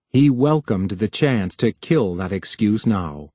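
ordinary delivery, nothing marked

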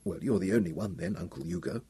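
quiet, mopey reply; girl answering her uncle, quiet and hurt; sad girl. Acted. Wanda's sad reply voiced.

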